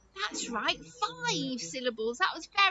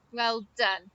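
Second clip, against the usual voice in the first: ordinary delivery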